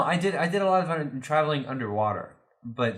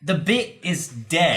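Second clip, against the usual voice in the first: slow, louder, higher declaration; emphatic player-to-player plea; 4 semitones higher, 5 dB louder, slower than usual. Real, not acted. Player telling friends to drop the joke, slow and emphatic. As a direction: spell it out firmly, word by word. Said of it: "Player to player".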